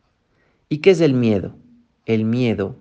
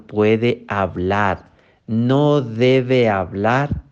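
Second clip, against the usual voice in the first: slow, stressed warning; emphatic slow delivery; much slower than usual. Shown as a demonstration. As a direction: drive each word home slowly. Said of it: "Escuche bien". (advertencia lenta y enfática)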